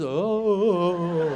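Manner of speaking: drawn-out dopey "oh"; spaced-out boy. Retold or imitated. Spaced-out teenage son missing everything, vacant drawn-out oh. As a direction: drift off into a vacant, lingering oh. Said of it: "boys get a little spaced out".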